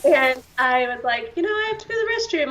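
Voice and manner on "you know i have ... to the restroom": high, fast and breezy, faking nonchalance; playing it off casually. To her parents, hiding why she needs the bathroom, high and breezy. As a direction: toss it off high and quick like nothing's up. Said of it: "trying to play it off like I wasn't doing anything".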